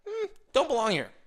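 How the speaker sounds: high, loud uneasy "Mm" then the words; awkward realization. Pretend. Walking onto a fancy golf course, awkward unease. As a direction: sound like you just caught yourself out of place. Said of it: "you're gonna know".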